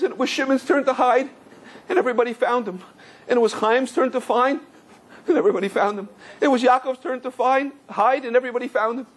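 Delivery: lightly voiced little boy; crying boy. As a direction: imitate a tearful child listing events. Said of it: "who was crying, was weeping very strong".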